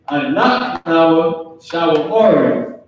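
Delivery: slow, drawn-out recitation; kids reciting back. Acted. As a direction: act the kids' answer, stretching each word. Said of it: "Depending on how strong they say it".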